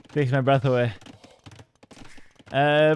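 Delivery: ordinary talk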